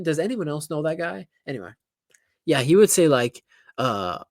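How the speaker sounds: usual speaking voice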